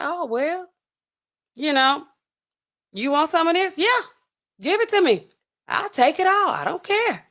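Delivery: high-pitched mock carefree user; high, devil-may-care take-it-all voice; uninhibited, carefree. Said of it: "it lowers your inhibitions".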